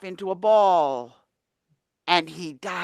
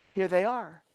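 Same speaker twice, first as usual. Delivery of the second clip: ordinary delivery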